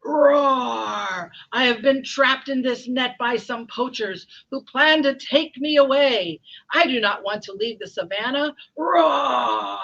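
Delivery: loud roaring complaint; distressed lion. Acted. Trapped lion to the mouse, roaring complaint.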